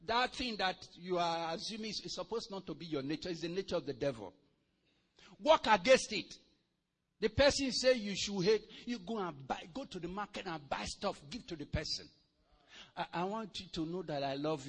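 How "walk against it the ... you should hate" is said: ordinary talk, nothing marked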